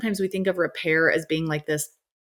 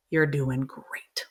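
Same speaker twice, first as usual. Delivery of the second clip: whisper; 6 semitones lower, 4 dB quieter, much slower than usual. Real, not acted. Closing reassurance to parents.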